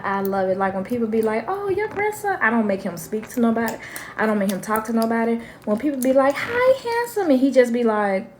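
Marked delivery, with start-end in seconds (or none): 1.5-2.4 high cooing greeting voice; sugary stranger gushing over a grandchild; sweet-talking stranger. Retold or imitated. 6.4-7.3 high sing-song greeting; high cooing hello to a toddler; cooing stranger. Retold or imitated. People greeting grandson, cooing.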